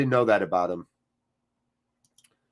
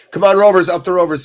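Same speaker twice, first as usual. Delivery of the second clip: much louder, higher rallying cry; cheering shout; 6 semitones higher, 10 dB louder, faster than usual. Real, not acted. Fan shouting his team on during the match, loud. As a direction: roar the team on from the stands.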